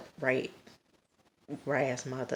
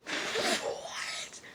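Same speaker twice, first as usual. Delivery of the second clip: aghast, whispered disbelief; shocked whisper; 4 dB quieter than usual. Retold or imitated. Viewer reliving the shooting, shocked whisper. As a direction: whisper it as if stunned, acted out.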